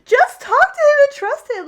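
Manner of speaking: high, loud plea to just trust him; urgent pleading advice. Retold or imitated. Friend urging Allie to trust her fiance, loud high plea. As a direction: cry out the advice as if begging her.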